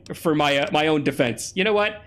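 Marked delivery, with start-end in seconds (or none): none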